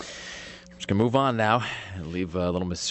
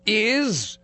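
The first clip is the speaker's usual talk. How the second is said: high, drawn-out "is"; stalling for the name; 13 semitones higher than usual. Real, not acted. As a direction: stretch the word out, pitched way up. Stalling while searching for a name.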